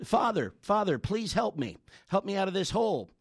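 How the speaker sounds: high, pleading cry for help; retold desperate plea. Man stuck in a hole begs the passing priest, desperate plea. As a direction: beg the priest from the bottom of the hole.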